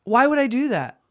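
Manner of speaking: high-pitched, baffled at himself; exasperated disbelief. Real, not acted. Man telling co-host of his lapse, exasperated disbelief. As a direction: voice rises, incredulous at your own mistake.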